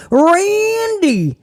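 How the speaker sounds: high, drawn-out mocking Randy; mock Riddle. Mocking Riddle calling out to Randy. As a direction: whine the name like a needy fan.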